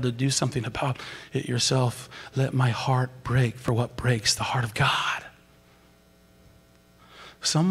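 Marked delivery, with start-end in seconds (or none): 2.3-5.2 hushed, breathy plea to God; soft whispered prayer. Real, not acted. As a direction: pray it under the breath.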